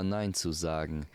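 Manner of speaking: ordinary delivery, nothing marked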